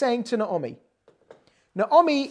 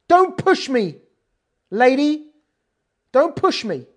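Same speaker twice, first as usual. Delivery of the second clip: defiant refusal; 2 semitones higher, 5 dB louder than usual. Retold or imitated. Rus defiantly telling Naomi to stop. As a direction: push back hard. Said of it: "I'm translating that".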